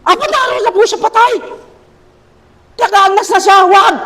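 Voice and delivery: high, half-laughing protest as Martha. Acted. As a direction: play Martha begging him not to open the tomb. (mataas at natatawang pagtutol ni Marta)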